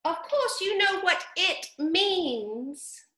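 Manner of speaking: high, mildly cross squeak; cross mouse. A narrator's reading. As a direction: snap back lightly at the duck. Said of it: "the mouse replied rather crossly".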